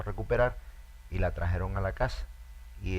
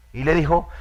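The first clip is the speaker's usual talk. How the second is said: usual speaking voice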